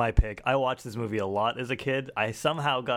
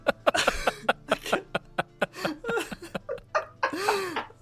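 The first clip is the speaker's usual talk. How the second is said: high, wheezing laughter; giggling fit. Real, not acted. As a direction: lose it all over again. Host still can't stop laughing.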